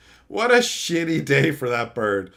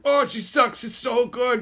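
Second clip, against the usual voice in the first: naive fan voice; 9 semitones higher than usual. Retold or imitated. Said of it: "you don't know any different".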